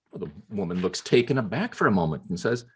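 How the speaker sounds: ordinary talk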